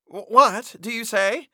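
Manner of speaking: high, loud stammer in disbelief. A narrator's reading. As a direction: trip over the first word, stunned. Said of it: "stammered Major Kovalov".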